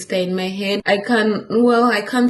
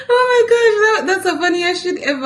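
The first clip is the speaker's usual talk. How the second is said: laughing; 11 semitones higher, 5 dB louder than usual. Real, not acted. Cracking up over her email.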